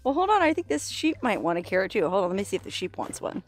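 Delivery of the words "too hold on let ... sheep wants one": ordinary talk, nothing marked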